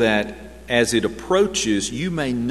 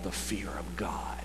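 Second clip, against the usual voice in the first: a reverent hushed whisper; 5 semitones lower, 12 dB quieter than usual. Acted. Preacher to congregation, awed whisper.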